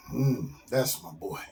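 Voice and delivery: low, soft fatherly approval; proud dad. Acted. Father watches his son fly off, quietly proud. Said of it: "Dad stands there looking proudly".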